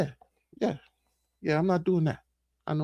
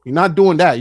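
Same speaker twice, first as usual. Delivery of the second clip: normal talking voice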